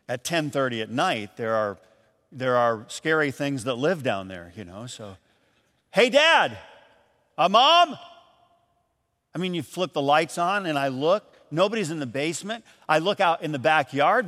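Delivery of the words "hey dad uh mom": calling out. Retold or imitated. Calling down into the basement.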